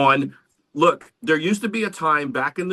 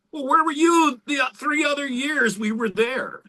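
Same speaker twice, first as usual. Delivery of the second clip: high, mocking comeback to the fans; pitched-up sarcastic "where were you"; sarcastic retort; 12 semitones higher than usual. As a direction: throw the question right back at them. Answering fans who ask about Germany.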